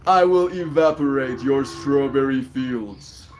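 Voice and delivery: slow, loud villain declaration; super villain. Pretend. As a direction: announce it like an evil mastermind. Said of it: "I sound like a super villain".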